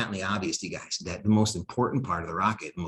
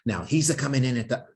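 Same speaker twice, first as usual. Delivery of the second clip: slightly higher mock Italian accent on "he's a coming"; 4 semitones higher, faster than usual. Lecturer slipping into a mock Italian accent, then catching himself. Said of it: "no more Italian accent".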